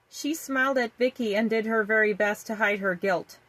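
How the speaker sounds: ordinary delivery, nothing marked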